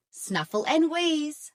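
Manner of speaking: plain reading voice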